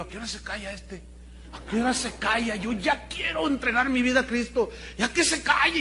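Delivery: impatient grumble. Retold or imitated. Young convert waiting for preacher to stop, impatient whine. Retells his impatience during the sermon.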